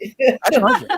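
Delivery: giggling while telling it; laughing. Real, not acted. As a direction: laugh the words out at the memory. Laughing about dog spooning.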